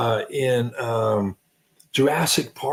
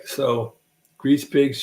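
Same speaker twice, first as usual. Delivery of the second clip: ordinary talk, nothing marked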